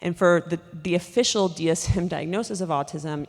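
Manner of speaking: normal talking voice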